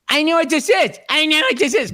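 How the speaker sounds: hammed-up know-it-all wife. Husband mimicking wife guessing every gift, smug. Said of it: "Any gift I give her".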